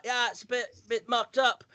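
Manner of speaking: Cockney. Acted. Prince answering his brother about the tie, mock Cockney. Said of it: "I'd do Cockney".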